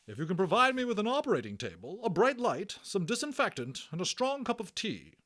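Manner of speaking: high, loud, quick orders; commanding matron. Acted. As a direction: rattle off her demands like a head nurse.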